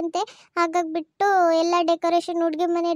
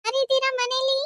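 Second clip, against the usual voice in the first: raised, high call into the house; 7 semitones higher than usual. Acted. Calling out at the door.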